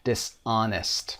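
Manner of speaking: dishonest said esanest, slow; silent H. Shown as a demonstration. Teacher modelling dishonest slowly for students. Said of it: "صدای H رو نمیشنوید".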